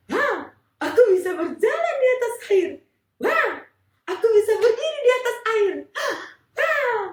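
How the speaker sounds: high, loud, fast laughing wonder; thrilled to walk on water; amazed delight. Acted. Peter walking on the water, high laughing amazement.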